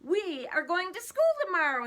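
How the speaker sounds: high, loud and cheery; farmer's loud announcement. Acted. Farmer announcing school to his cows, loud and cheery. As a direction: call it out across the farmyard.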